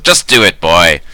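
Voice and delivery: yelling. Acted. Mayor yelling at Hob to obey, loud impatient yell. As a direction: snap the order at him loudly. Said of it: "she yells at him again".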